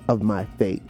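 normal talking voice

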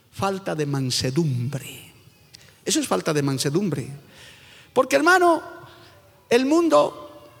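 ordinary talk, nothing marked

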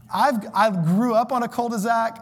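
ordinary talk, nothing marked

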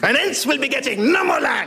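high, imperious French put-down; French accent. Acted. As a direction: dismiss him in hammy high French. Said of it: "in outrageous French accent".

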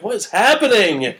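laughing disbelief. Retold or imitated. Retelling baffled reaction, laughing.

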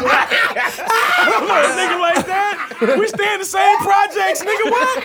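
high laughing voice, half shouted; high-pitched laughing mock protest; laughing mock denial. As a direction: squeal it out through laughter. Mocking Frank's denial, laughing.

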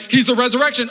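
high, loud mock preaching; hammed-up proclamation; misjudged proclamation. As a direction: blurt it out triumphantly at a mourner. Blurting truth at a crying person.

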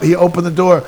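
ordinary delivery

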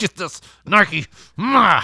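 slow loud yell; shouted call. Pretend. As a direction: bark it out slowly. Dog voiced, calling out.

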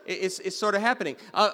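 usual speaking voice

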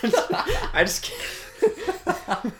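words squeezed out through high laughter; can't get the words out for laughing; laughing helplessly. Real, not acted. Loses it laughing mid-sentence.

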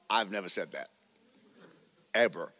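hushed, deep aside with a pause before the last word; low, quiet denial. Retold or imitated. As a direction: drop the voice right down to deny it. Deadpan retort to being misquoted.

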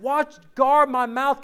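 ordinary talk, nothing marked

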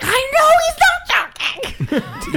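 high, slow, laughing through it; fed up and cracking up; laughing exasperation. Retold or imitated. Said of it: "I'm like".

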